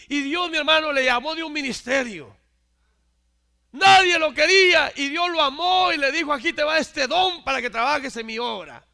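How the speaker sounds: loud, high preaching climax; fiery preaching. Acted. Preacher proclaims God loved the unloved, loud and fervent. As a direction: drive the line home with full voice.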